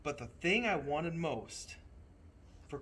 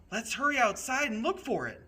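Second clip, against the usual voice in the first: put-on higher, louder, eager child's voice; 10 semitones higher, 7 dB louder than usual. A narrator's reading. A child urging the others to search, excited and higher. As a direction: hurry them out, bright with urgency. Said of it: "one of the children said".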